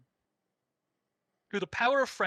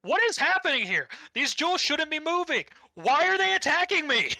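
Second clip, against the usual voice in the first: much higher, hammed-up flustered king; panicked villain; 10 semitones higher, 6 dB louder than usual. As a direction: overplay the villain's confused alarm. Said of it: "him going".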